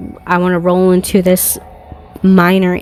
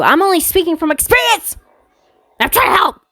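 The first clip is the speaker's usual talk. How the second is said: squeaky, exasperated plea; high-pitched protest; 10 semitones higher, faster than usual. Real, not acted. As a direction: protest in a much higher voice. Exasperated outburst between laughs.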